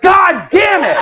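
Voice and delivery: hammed-up shriek of defeat; mock shout. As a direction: explode in comic frustration. Third rival trumps him, shouting.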